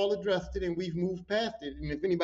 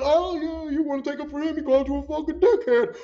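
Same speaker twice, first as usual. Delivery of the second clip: high, laughing taunt; gleeful instigator; 7 semitones higher than usual. Retold or imitated. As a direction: stir up trouble, then burst into high laughter. Instigator stirring trouble, laughing.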